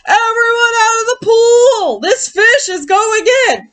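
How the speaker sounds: high, loud, slow bellowing shout; fireman's yell. Acted. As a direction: clear the pool with a booming yell. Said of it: "The fireman yelled".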